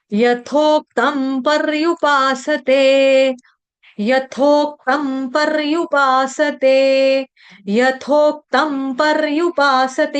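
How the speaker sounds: यथोक्तं पर्युपासते drawn out slowly, each syllable clear; slow careful recitation. Shown as a demonstration. Teacher reciting पर्युपासते slowly for devotees to repeat. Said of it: "ये पर्युपासते word तो आपके लिए पुराना".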